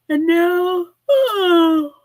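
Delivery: high, loud yawn swallowing the words; real yawn mid-speech. Real, not acted. Sleepy host surrounded by napping pets, breaking into a yawn. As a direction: start talking and get overtaken by a yawn.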